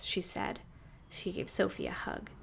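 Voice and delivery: ordinary delivery, nothing marked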